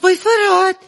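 high-pitched, hammed-up wifely concern; mock alarm. Wife asks husband about his tied tongue, mock alarm. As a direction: start high in pretend worry, then ease down.

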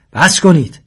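high, loud command to stop; shouted order. A narrator's reading. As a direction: shout to halt everyone. Cornered, ordering everyone to stop. (فریاد آمرانه و بلند)

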